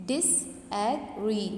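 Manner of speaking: "disagree" very slowly in three separate syllables. Shown as a demonstration. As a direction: break "disagree" into dis, a, gree with pauses. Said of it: "it is demarcated into three syllables".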